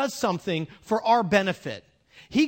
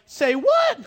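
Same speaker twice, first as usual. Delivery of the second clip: high-pitched, loud incredulous squawk; comic disbelief; 14 semitones higher, 7 dB louder than usual. Acted. Onlookers told to unwrap Lazarus, high comic disbelief. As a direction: leap way up in mock shock. Onlookers' stunned reply, acted.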